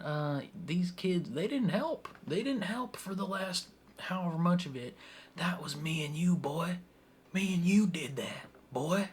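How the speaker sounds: put-on a silly accent on "boy"; Father Bones. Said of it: "stupid accent on it".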